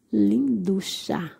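slow, stretched-out wonder; drawn-out admiration. Real, not acted. Vlogger adoring a plant before viewers, slow awed praise. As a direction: linger adoringly on each word.

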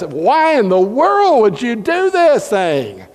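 slow, high, pressing disbelief; emphatic sailors. Acted. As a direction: sailors demanding an answer from Jonah. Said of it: "a little bit more emphatic with it".

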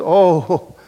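Jeremiah lamenting. Acted. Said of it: "Jeremiah said something like this".

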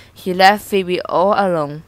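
ordinary delivery, nothing marked

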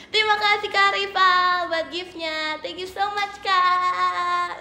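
sing-song thanks. Real, not acted. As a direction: stretch the last words out as you thank. Thanking a gift sender. (ucapan terima kasih panjang mendayu)

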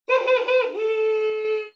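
high giggling hee hee; high alto giggle. Shown as a demonstration. Elementary school laughter, in alto.

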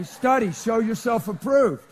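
loud, drawn-out coaching shout; hearty cheering-on. Acted. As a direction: egg him on loudly like a proud coach. Acted cheering on of Michael.

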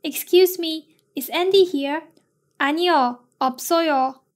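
ordinary talk

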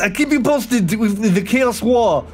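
put-on light French-accented reporter voice; a hint of a French war reporter; Lesnok the war correspondent. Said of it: "Okay, Lesnok".

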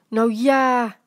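beledigd en luider geroepen; verongelijkte uitroep. A narrator's reading. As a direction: roep het verontwaardigd uit. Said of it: "riep oud-tante Miep verongelijkt". (verongelijkt, luid uitgeroepen)

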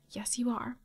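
soft whispered tease; teasing whisper. Real, not acted. Gamer teasing her Sims, soft sly whisper.

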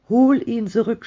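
high, urgent outcry; cried command. A narrator's reading. Old wife drags husband to door, crying out high. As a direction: cry out, pitch held high. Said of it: "schrie die alte Frau".

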